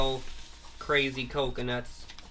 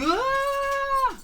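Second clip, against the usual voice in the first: very high, loud screech; shrill monster scream; 6 dB louder than usual. Retold or imitated. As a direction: screech like a sonic blast. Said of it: "She's like".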